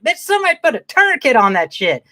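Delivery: high, loud exasperated outburst; mock outraged yell. Viewer yelling at the movie, high exasperated outburst. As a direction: yell it at the screen in disbelief. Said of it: "I'm like".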